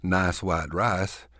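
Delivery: strong Texas accent, slow; Texas monophthong. Shown as a demonstration. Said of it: "If you say them like this".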